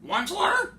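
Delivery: high, loud croaked call of Onceler; Lorax croaking cry. Acted. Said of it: "he cried with a coughless croak".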